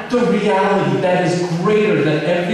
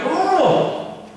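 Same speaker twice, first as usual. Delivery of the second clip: higher, snippy mock-sweet jab with a laugh, fast; sarcastic inner voice; 8 semitones higher than usual. Martha silently mocking Mary while serving, higher and snide. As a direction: needle her with fake sweetness. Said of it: "she would look at Mary and be like".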